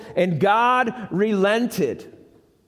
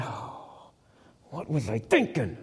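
low, soft, breathy regret; regretful Jonah; 8 semitones lower, 8 dB quieter than usual. Acted. Jonah regretting inside the fish, soft low muttering. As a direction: mutter it to yourself in the dark.

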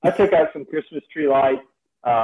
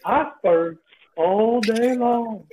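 narwhal voice; 6 semitones higher than usual. Pretend. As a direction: play a goofy cartoon narwhal. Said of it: "the narwhal voice".

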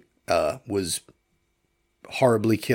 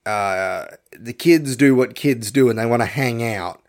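ordinary delivery

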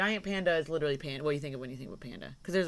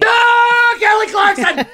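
high, loud excited shout; 21 dB louder, slower than usual. Pretend. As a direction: yell the name like a soundbite. Shouts the soundbite name.

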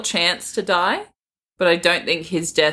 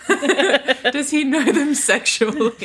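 laughing; 5 semitones higher, slower than usual. Real, not acted. Joking through laughter.

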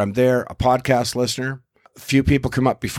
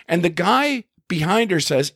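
ordinary talk, nothing marked

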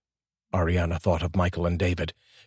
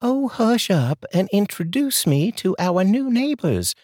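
high, folksy Southern neighbor voice; a chatty Southern lady offering coffee; sweet southern drawl; 12 semitones higher than usual. Acted. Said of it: "that sweet southern drawl".

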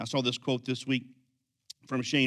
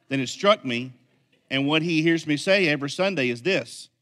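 usual speaking voice

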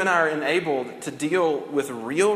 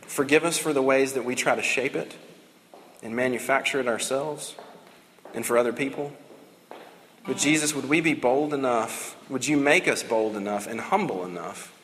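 calm, lower closing prayer; 3 semitones lower than usual. Acted.

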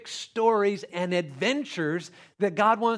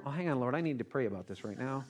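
low, hushed, mock-pious praying; softly hammed-up piety; mock prayer; 7 semitones lower, 10 dB quieter, faster than usual. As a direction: murmur a stalling prayer to the Lord. Mocks stopping to pray before a lion.

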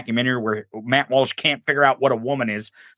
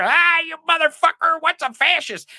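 much higher, squealing mock rage; mocking impression of trolls. Mocking right-wingers in Twitter space.

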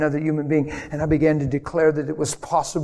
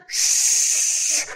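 long, voiceless "shh"; drawn-out hush; hushing shush. Retold or imitated. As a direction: shush someone mid-prayer. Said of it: "and he goes".

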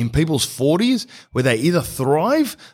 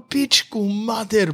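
drawn-out exclamation; 9 semitones higher, much slower than usual. Acted. Comic exasperated exclamation.

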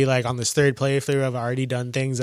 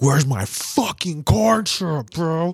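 mock craving; 5 semitones higher, much slower than usual. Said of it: "we're gonna be like".

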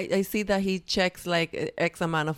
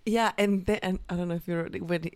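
usual speaking voice

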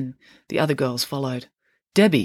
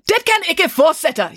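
high, loud, slow yell through a door; girl yelling in Norwegian; 13 semitones higher, 5 dB louder, slower than usual. Acted. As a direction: bellow it out furiously, much higher and louder. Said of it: "yelled a girl".

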